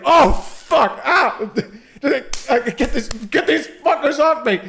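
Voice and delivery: high, loud panicked yell with laughter; panicked photographer. Pretend. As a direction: scream as bees swarm you. Photographer attacked by bees.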